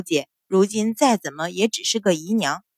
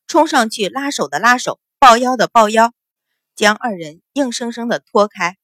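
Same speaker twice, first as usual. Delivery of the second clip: plain reading voice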